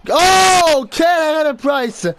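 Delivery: high, loud burst of amazement; excited shout. Real, not acted. Player amazed by Price's save, excited shout. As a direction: yell out the great save.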